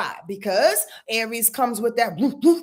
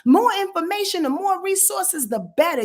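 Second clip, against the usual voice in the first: hyped Gemini; 6 semitones higher, 4 dB louder than usual. Retold or imitated. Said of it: "Gemini energy is like".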